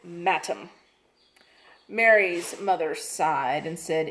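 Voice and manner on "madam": madam said as "matum", with a t and a u; a rude child mangling madam into "matum"; mispronounced madam. A narrator's reading. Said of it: "She pronounced it".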